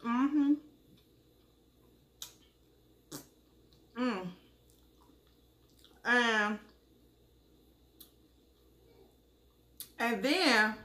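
talking while eating; chewing food. Real, not acted. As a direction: mouth full of food. Seen 0: Bites food at 0.3, clearly chewing through 11.7, mouth moving.

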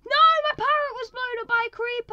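very high, very loud wail of outrage; distraught. Real, not acted. Player whose parrot just got blown up, distraught loud wail. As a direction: cry out as your pet is lost.

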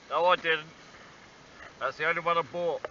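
ordinary talk

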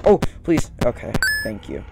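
ordinary talk

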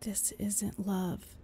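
slow firm whisper. Acted. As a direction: whisper it with quiet conviction. Angel whispering firmly.

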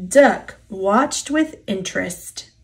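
usual speaking voice

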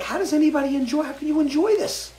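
high, loud, fast yelp of disbelief; retelling the bidet's sting, a shrill mock cry; pained outcry. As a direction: squeal it like the spray just hit you. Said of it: "it hurt so bad when it hit me that I was like".

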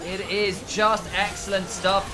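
ordinary talk

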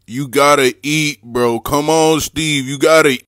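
high, loud yelling, slow on you gotta eat; loud pleading. Real, not acted. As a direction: beg the turtle at the top of your lungs. Begging turtle to eat.

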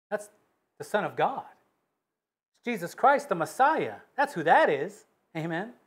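ordinary delivery

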